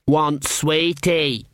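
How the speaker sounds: put-on slow, gruff toddler demand; a small child growling for sweets; deep child growl. A narrator's reading. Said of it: "growled Pusey in that curiously deep voice".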